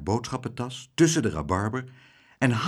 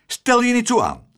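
much higher, louder stern schoolmistress voice; stern old lady; 14 semitones higher, 8 dB louder than usual. Acted. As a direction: snap at him to stop fussing. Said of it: "zei juffrouw Klaterhoen streng".

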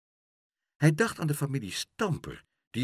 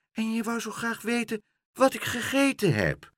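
taunting cat; 12 semitones higher than usual. Acted.

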